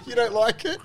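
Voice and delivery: high squealing laughter through the words; can barely speak for laughing; laughing hard. Real, not acted. Host cracking up as the player gags on Vegemite.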